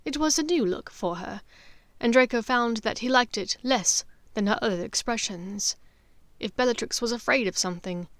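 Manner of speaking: plain reading voice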